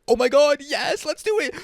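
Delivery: mock the cops' eagerness to shoot; mock eager officer. Mocking officers eager to open fire, high hammed-up glee. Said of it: "they're like".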